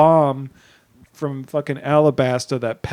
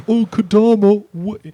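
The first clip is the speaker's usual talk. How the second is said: high loud silly voice; hammy high voice; 7 semitones higher, 6 dB louder than usual. Acted. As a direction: ham up a goofy gasp. Mocking the crew man.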